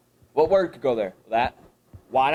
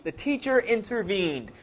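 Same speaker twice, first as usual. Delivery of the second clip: The teacher intervened read slowly and higher; deliberate slower reread; 7 semitones higher than usual. Shown as a demonstration. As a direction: linger on teacher. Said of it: "bracket this one".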